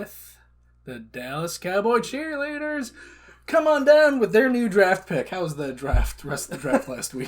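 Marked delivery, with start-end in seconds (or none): 0.0-5.3 announcer voice. Pretend. Announcer-style hype of draft.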